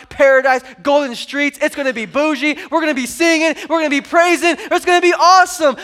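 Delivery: loud, high, climbing list; excited preaching. Real, not acted. Preacher selling heaven to congregation, rising loud excitement. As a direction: build the hype line by line.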